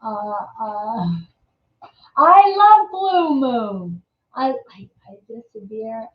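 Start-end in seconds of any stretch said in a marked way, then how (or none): 2.2-4.0 gushing. Real, not acted. Gushing about favourite beer.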